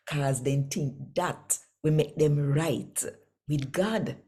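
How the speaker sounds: ordinary delivery, nothing marked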